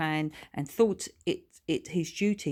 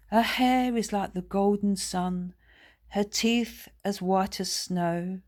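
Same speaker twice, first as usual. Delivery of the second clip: recited poem; 3 semitones higher than usual. A narrator's reading. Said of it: "he recited".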